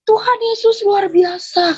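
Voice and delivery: high, drawn-out exclamation; retold cry out. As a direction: imitate her crying out in amazement, slowly. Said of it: "dia teriak ngelihat muka kita".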